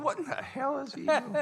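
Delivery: very high, amused mock bewilderment; laughing disbelief. Bandleader recalls watching his guitarist play, laughing mock disbelief. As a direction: squeal it through a laugh, baffled. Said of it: "I'm smiling, laughing, going".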